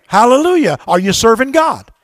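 ordinary delivery

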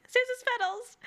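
hammed-up movie quote; imitating Jimmy Stewart. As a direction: cry it out like a desperate dad in a film. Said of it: "we're like".

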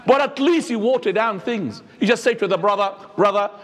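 ordinary delivery

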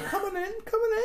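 very high squeaky taunting voice; mock taunt. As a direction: squeal it like a jeering wind-up. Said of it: "having a row with the guy going".

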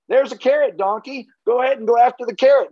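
high, bright coaxing call to a donkey. Retold or imitated. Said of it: "you go".

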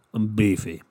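"beefy" punched, falling at the end; say-along demo. Shown as a demonstration. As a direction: lead the chant, leaning on "beefy". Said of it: "Say it with me".

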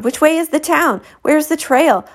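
high, panicky string of questions; frantic questions. Acted. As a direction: fire off questions, lost and alarmed. Jules frantic, lost while fleeing.

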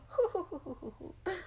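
hooting little "hu-hu" chuckle; playful giggle. Acted. Rabbit jokes about his carroty smell, playful giggle. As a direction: giggle at your own silly joke.

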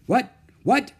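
high, loud startled what; shocked by the news on the phone. Acted.

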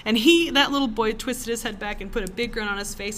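normal talking voice

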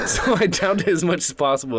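talking through laughter; chuckling while telling it; laughing. Real, not acted.

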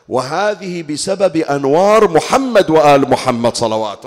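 loud, slow blessing call; preaching at full force. Acted. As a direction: proclaim it to the congregation. Loud sermon blessing formula.

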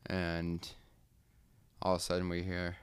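ordinary talk, nothing marked

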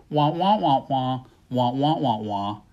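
mock Peanuts teacher drone; muffled wah wah talk. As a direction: imitate the muffled cartoon teacher. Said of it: "all the listeners are hearing is".

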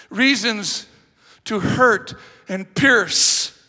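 loud, slow stress on reasons; drawn-out pulpit emphasis; emphatic preaching. Acted. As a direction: lean on each word.

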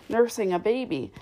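ordinary delivery

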